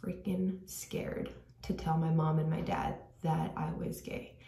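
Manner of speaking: half-whispered, embarrassed admission; lowering the voice on the hard word; hushed confession. Real, not acted. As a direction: drop to a near whisper on the secret. Confessing a secret, dropping to whisper.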